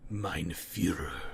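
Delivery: put-on hushed low German-accented plea; a hint of a whispered German accent. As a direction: murmur it reverently to the leader. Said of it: "he whispered".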